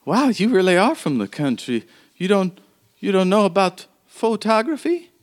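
put-on the old woman marvelling that the visitor has never seen a photograph; old woman npc. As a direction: tease the country girl kindly.

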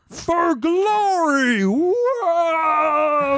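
high, drawn-out war cry; battle cry. Acted. Ponies bursting out of the cake, high battle cry. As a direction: charge into battle on a long yell.

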